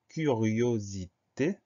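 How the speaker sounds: CURIOSITÉ split slowly as cu-rio-si-té; syllable by syllable. Shown as a demonstration. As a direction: dictate "curiosité" in drawn-out, separate syllables. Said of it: "Première syllabe".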